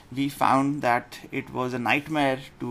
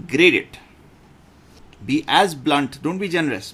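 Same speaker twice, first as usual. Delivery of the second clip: firm command; 2 semitones higher, 6 dB louder than usual. Real, not acted. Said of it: "urging the class to grade".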